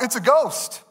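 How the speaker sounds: storyteller's touch of alarm; frightened cry. A narrator's reading. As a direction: read it as a fearful outcry. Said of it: "In their fear, they cried out".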